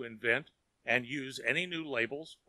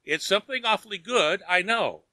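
bright eager rush, a touch higher and louder; 7 semitones higher, 7 dB louder than usual. A narrator's reading. Daughter telling father of Tom's note, eagerly. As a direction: daughter eagerly hinting at good news. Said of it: "she paused eagerly".

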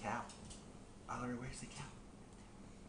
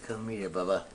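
ordinary talk

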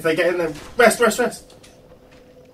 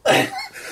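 high wheezy laugh. Real, not acted. Laughs at being teased.

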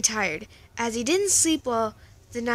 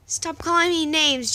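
loud, higher angry shout; screaming; 5 semitones higher, 6 dB louder than usual. Acted. Jack screaming back at the bullies, furious. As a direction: yell it, fed up. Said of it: "Jack screamed".

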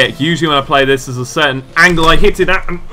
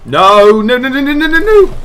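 no's rising from plain to a loud, high cry; panicked no building; 8 semitones higher, 5 dB louder than usual. Real, not acted. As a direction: start the no's normally and climb into a panicked yell. Goal about to be conceded.

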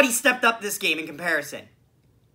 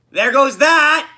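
higher, louder, frustrated cry; exasperated outburst; 4 semitones higher, 6 dB louder, slower than usual. Real, not acted. Fan seeing their typed prediction fail, exasperated. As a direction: call it out in loud frustration. Prediction about goalie just failed.

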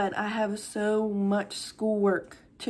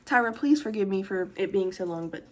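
normal talking voice

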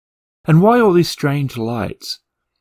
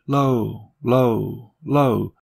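echo fading; 3 dB quieter, much slower than usual. Acted. Tunnel echo of his call.

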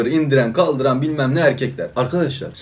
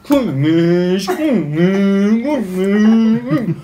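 high, drawn-out cooing over the cat, a touch louder; higher and higher cooing, teasing the partner; mock cooing partner; 6 semitones higher, 4 dB louder, much slower than usual. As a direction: coo it like a smitten owner squeezing a pet. Mimics partner squeezing the cat.

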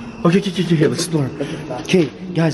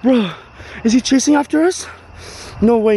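being chased; 6 semitones higher than usual. Real, not acted. Fleeing a peeking killer clown.

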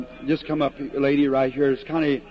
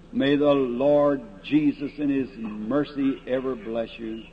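ordinary talk

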